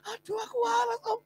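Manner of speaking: high excited cry of healing; high joyful declaration; joyful exclaim. Retold or imitated. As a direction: burst with joy at being well. Healed man embracing pastor.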